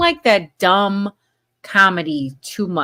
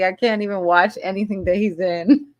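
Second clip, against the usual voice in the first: normal talking voice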